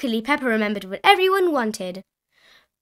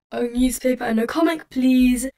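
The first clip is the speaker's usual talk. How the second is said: character voice near the narrator's own, louder on "please"; Grandad Dog and Danny Dog; 4 dB louder than usual. A narrator's reading. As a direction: put in a polite order as the dogs. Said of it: "Grandad Dog and Danny Dog said".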